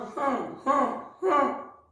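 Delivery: हाँ as one soft, high nasalised syllable; correct nasal sound. Shown as a demonstration. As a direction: demonstrate हाँ softly, separated, with no ण. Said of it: "इसका उच्चारण मैं बोल रहा हूं".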